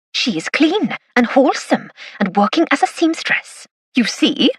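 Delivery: put-on Welsh Valleys accent. Narrator joking to the inspector, Welsh Valleys accent. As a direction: play up a Welsh Valleys accent, vouching for her character. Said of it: "in my best Welsh Valleys accent".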